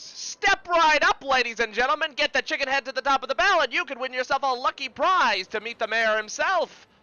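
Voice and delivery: loud, high-pitched carny pitch, rolling on briskly; yelling carnival barker. Acted. Carny yelling to kids lined up at the game, showman's shout. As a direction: holler it out to pull in a crowd. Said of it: "he yells to the kids".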